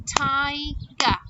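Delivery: "Tiger" split into two clapped beats; syllable by syllable. Shown as a demonstration. Teacher claps syllables with children, "Tiger" in two beats. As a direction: break "Tiger" as Ti, pause, ger. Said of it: "Do it again".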